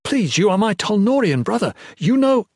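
rising, heated plea; desperate pleading. Acted. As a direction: implore a brother, pitch climbing until cut off.

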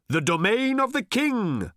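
high, projected shout; shouting back. A narrator's reading. Old man answering Arthur on the beast, shouting back. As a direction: holler the answer up to the rider. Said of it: "shouted old Thrashbarg back".

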